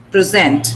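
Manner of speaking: verb-style Present, weight at the end; second-syllable stress. Shown as a demonstration. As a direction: Present as pri-ZENT. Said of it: "You have to stress sent".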